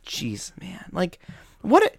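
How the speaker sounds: whispered oh jeez, then rising; breathy disbelief, a hushed sigh of frustration; under-breath groan. Retold or imitated. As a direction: mutter it in dismay. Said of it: "I'm like".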